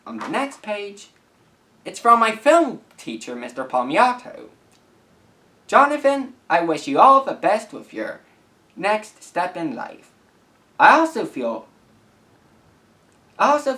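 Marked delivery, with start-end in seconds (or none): none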